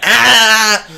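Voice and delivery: ordinary talk, nothing marked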